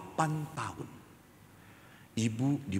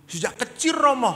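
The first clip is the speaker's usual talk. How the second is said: put-on high, loud, excited kid; very eager child; 9 dB louder than usual. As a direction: shout yes like a keen pupil. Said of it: "semangat sekali".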